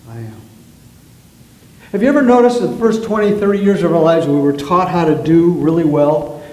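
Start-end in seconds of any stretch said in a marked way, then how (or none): 0.1-0.4 quiet, slow and lower; hushed and lowered, like a prayer; soft mantra. Shown as a demonstration. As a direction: breathe it out gently. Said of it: "and say".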